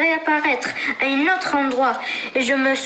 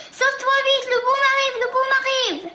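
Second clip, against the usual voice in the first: high panicked shout; 9 semitones higher, faster than usual. Acted. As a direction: yell the warning in alarm. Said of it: "en criant".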